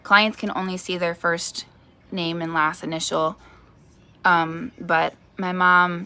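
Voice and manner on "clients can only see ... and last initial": ordinary delivery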